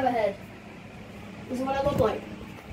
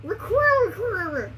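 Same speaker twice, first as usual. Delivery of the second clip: squeaky voice; 8 semitones higher than usual. Acted. Garbled word game, high voice.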